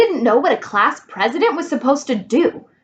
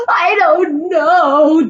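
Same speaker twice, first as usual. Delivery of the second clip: slow, higher, louder sob; sobbing; 6 semitones higher, 5 dB louder, much slower than usual. Acted. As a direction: barely get it out while sobbing. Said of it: "Dana sobbed".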